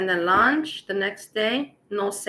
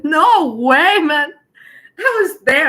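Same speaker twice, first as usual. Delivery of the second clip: excited; 7 semitones higher, 10 dB louder, slower than usual. Real, not acted. Boasting about skipping the concert.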